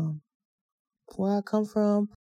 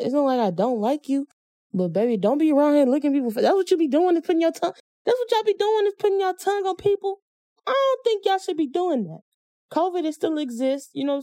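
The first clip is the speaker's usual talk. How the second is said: high-pitched, exasperated telling-off; mock scolding; 7 semitones higher, faster than usual. Addressing an absent date who licks faces, exasperated scolding. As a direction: scold them in a raised, incredulous pitch.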